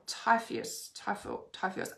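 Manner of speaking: whispering the name to herself; a soft whispered try at Typheus; muttered name try. Real, not acted. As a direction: sound the name out under your breath. Sounding out a hard name.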